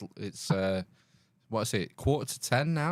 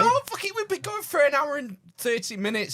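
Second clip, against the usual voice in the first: much higher, sudden protest; exasperated outburst; 11 semitones higher than usual. Real, not acted. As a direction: blurt it out, fed up. S1 protests a new topic late on.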